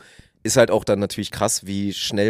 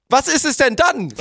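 much higher, slow disbelieving question; incredulous demand; 3 dB louder, slower than usual. Retold or imitated. Player pressing his partner, incredulous and high. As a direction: press him for the real reason. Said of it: "Und dann so".

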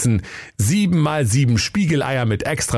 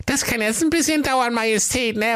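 sehr hohe, missmutige Henkerstimme; mürrisch hoch reden; Henker. Acted. (missmutiger Henker)

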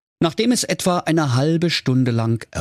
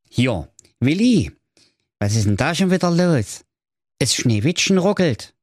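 lightly voiced Saxon fairy-tale brother, pitched up; a bit higher, puzzled Saxon character voice; Jakob Grimm; 5 semitones higher than usual. Jakob alerts his brother to a glitch, lightly voiced character. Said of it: "sprach der Jakob Grimm".

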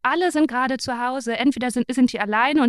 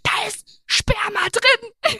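high, slow, exasperated outburst; exasperated blurt; 10 semitones higher, much slower than usual. Retold or imitated. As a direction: blurt it out, fed up at last. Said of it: "auch angeschrien".

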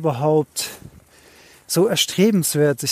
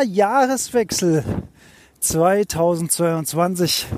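ordinary delivery

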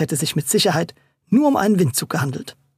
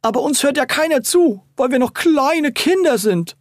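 plaintive whining; 8 semitones higher, 3 dB louder than usual. A narrator's reading. Brother laments being ignored as a child, plaintive whine. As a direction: whine like a little kid nobody listens to. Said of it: "fügte sein Bruder klagend hinzu".

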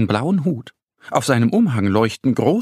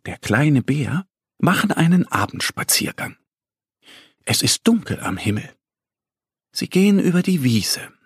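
ordinary delivery, nothing marked

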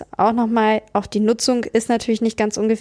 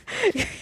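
quick amused giggle; a brief laugh at the slip; short laugh; 9 semitones higher, 5 dB quieter than usual. Real, not acted. Laughs after forgetting intro. (kurzes Lachen)

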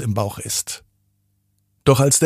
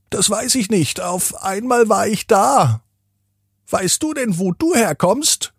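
higher, strange scarecrow voice; 9 semitones higher than usual. A narrator's reading. Scarecrow greets Dennis in the garden, higher storybook voice. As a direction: introduce yourself as a friendly scarecrow. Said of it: "fragt die Vogelscheuche Dennis".

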